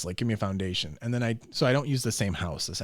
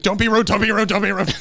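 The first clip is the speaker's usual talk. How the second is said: scolding dad; 9 semitones higher, 10 dB louder than usual. Retold or imitated. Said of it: "he's like".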